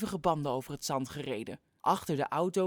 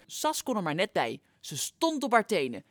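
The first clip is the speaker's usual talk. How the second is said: plain reading voice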